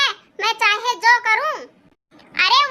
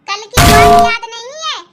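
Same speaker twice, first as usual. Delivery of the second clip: angry snap; 7 dB louder than usual. Acted. Wife threatens husband in quarrel.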